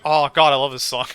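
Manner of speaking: loud, delighted gush over the music; excited outburst. Retold or imitated. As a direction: blurt out your love for the song. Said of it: "And I was just like".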